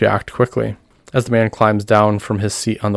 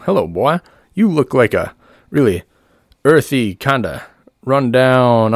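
higher, measured huckster patter; showman; 4 semitones higher, 3 dB louder than usual. Acted. Doctor Paul greeting the boy before the crowd, showman's patter. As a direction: size someone up for an audience.

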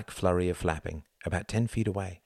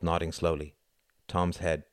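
usual speaking voice

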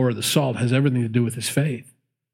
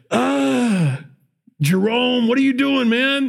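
much higher, exasperated groan at a friend; disgusted complaint; 9 semitones higher, slower than usual. Acted. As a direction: whine it while spitting out food. Prophets spitting out the food.

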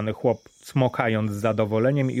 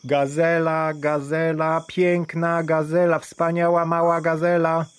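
hawker's cry; 7 semitones higher than usual. A narrator's reading. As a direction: sell it to the whole street. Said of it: "woła na całe gardło".

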